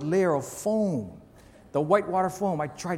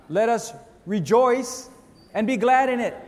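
preaching; 5 semitones higher, 4 dB louder than usual. Acted. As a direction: lift it up like a declaration. Sermon climax, answering own question.

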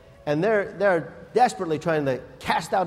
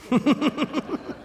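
airy laugh; 10 semitones higher than usual. Real, not acted. Laughing at the story.

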